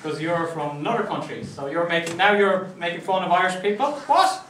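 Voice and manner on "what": much higher, louder, indignant yelp; a fake-shocked what; hammed-up indignant squeak; mock shocked what. Mock outrage at the joke.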